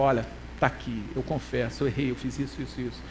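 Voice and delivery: low, soft murmured admission; hushed, humble owning up to mistakes; quiet confession. Acted. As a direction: confess softly, voice sinking away. Acted humble confession to advocate.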